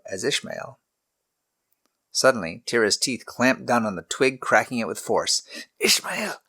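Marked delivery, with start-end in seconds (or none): 5.8-6.4 urgent, breathless whisper; hissed whisper. A narrator's reading. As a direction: whisper the name sharply, warning him. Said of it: "he hissed".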